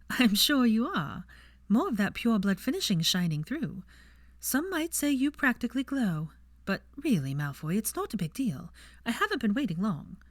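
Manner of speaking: gently mocking him about pure blood; lightly voiced Granger. A narrator's reading. Granger's dialogue read in character.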